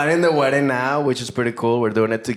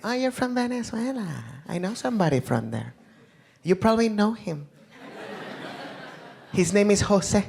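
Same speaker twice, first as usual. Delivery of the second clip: higher, softer, lightly voiced lady; chatty lady; 8 semitones higher, 5 dB quieter than usual. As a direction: play an eager stranger sure you know him. Lady approaching him.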